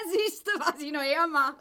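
giggling boast with laughter; laughing. Acted. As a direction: laugh it off, showing off. Wife laughs, flirtatious boast. (kokettes Lachen)

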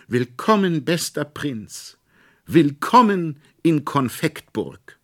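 high, loud, slow courtly greeting; little courtier's showy high welcome; grand welcome. A narrator's reading. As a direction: hail the prince with pomp.

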